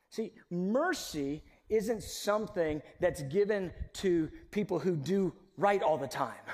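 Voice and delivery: usual speaking voice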